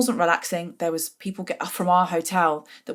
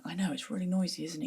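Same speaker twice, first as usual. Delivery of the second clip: quiet reply; 10 dB quieter than usual. Retold or imitated.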